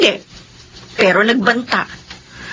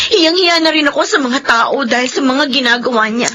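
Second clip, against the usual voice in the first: normal talking voice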